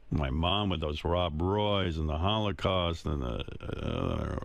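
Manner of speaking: normal talking voice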